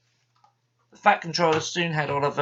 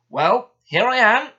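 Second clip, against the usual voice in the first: loud, high, jolly greeting; cheery voice; 11 semitones higher, 11 dB louder than usual. A narrator's reading. Fat Controller arriving among silent engines, loud and cheery. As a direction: breeze in beaming. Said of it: "said a cheery voice".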